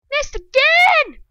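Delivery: high, slow whine of annoyance; dragged-out frustrated cry; frustrated yelp. Real, not acted. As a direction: stretch it out in disbelief. Missed shot in game.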